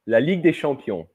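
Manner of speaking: La Ligue des Champions in native French, des as /de/ with a silent S; French pronunciation. Shown as a demonstration. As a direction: model the French, dropping the S on des. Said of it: "it's a mute S towards the end".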